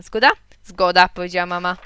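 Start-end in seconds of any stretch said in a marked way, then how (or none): 0.0-0.3 asking question. A narrator's reading. Asks mother to agree. 0.6-1.1 firm agreement. A narrator's reading. Mother agrees, powiedziała mama.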